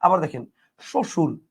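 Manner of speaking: usual speaking voice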